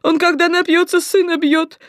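high, loud keening plea; wailing. Acted. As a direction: wail and beg the doctor. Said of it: "заголосила блондинка".

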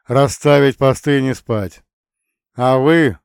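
громкий командный голос; отдать приказ громко, как командир; твёрдый приказ. A narrator's reading. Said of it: "твёрдо приказал". (громко и твёрдо)

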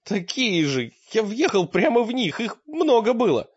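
high-pitched tearful complaint; tearful, broken voice. A narrator's reading. Battered Таргитай complains of the stones, tearful high whine. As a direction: wail it high, like a hurt boy. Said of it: "сообщил он плачущим разбитым голосом".

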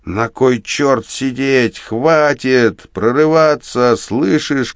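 high-pitched furious outburst, slow and drawn out; angry shouting. A narrator's reading. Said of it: "кричит, ругается он". (сердитый крик с руганью)

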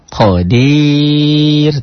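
Qadir drawn out very slowly, the final R light and thin; thin ro at pause. Shown as a demonstration. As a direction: stretch Qadir, ending on a thin R. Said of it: "Kalau dihentikan".

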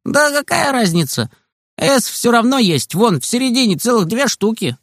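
put-on high, far boyish voice, easygoing; Kostik's voice. A narrator's reading. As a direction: lift into a carefree kid friend.

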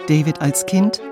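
ordinary talk

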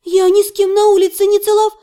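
high, loud protest cut mid-word; breaking off abruptly. A narrator's reading. Heroine denying the kiss, high protest suddenly cut short. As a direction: protest loudly, then stop dead. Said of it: "сказала я и осеклась".

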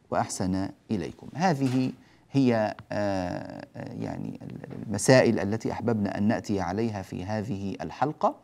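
ordinary talk, nothing marked

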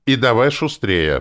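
loud, high, scornful order; contemptuous. A narrator's reading. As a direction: toss out the command with disdain. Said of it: "презрительно бросил третий".

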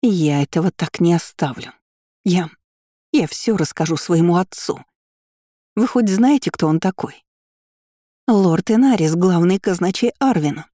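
indignant threat, swelling mid-speech; trembling with rage. A narrator's reading. Rival threatening the departing students, voice meant to tremble with rage. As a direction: build towards an outraged boast about father. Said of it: "дрожащий от ярости голос Армелии".

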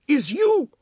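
high, loud accusing cry; sharp high-pitched accusation; angry attacker. A narrator's reading. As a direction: fling it out with a blow. Attacker speaks with every blow.

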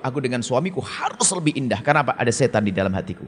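a wife steeling herself against temptation, softly; quiet inner voice. Retold or imitated. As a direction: murmur it to yourself.

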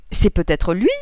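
loud, eager exclamation; exclaimed question. A narrator's reading. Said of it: "s'écria madame Verdurin". (question lancée comme une exclamation)

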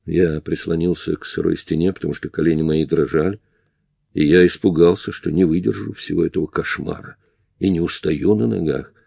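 plain reading voice

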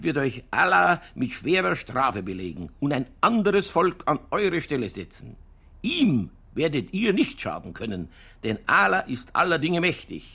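high, slow, weighty pronouncement; solemn scripture reading. Acted. Innkeeper reads the holy war verse aloud, grave and declaiming. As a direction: proclaim it as a stern warning from the book. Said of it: "Ja, schau her".

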